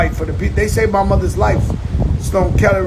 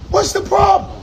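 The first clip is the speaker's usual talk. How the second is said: high shout; 8 semitones higher than usual. Acted. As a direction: yell "What's the problem?" up high. First take shouted high.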